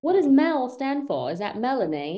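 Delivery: curious mock British question; playful British query; fake British accent. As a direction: ask as a put-on Brit. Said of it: "with the British accent".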